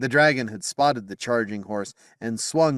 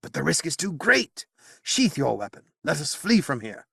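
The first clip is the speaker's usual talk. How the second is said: higher, urgent warning wizard voice; wary old wizard; 7 semitones higher than usual. Acted. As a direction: urge the king to flee. Merlin voiced in narration.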